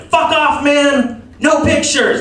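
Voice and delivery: a hammed-up star yelling at paparazzi; mock shout. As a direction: yell at the photographer like a star. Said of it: "I'm like".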